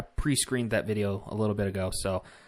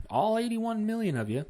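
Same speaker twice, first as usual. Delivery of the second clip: all eighty-one million of you pitched much higher for emphasis; pointed emphasis; 11 semitones higher than usual. Shown as a demonstration. Vlogger stresses the vote count to Democrat viewers, pitched high. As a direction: lift the whole phrase up high to make the point. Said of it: "I'm doing that for reasons".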